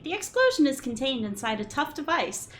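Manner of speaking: ordinary delivery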